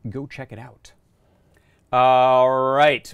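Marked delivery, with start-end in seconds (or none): 1.9-3.1 booming segment intro; loud announcement. Real, not acted. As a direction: call it out like a show host. Segue to next segment.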